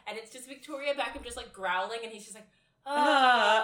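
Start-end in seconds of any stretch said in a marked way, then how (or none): 2.8-3.6 loud, higher-pitched comic wail; hammed-up yell of fright; mock frightened scream. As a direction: imitate a terrified cry. Acts man reacting to growling Victoria.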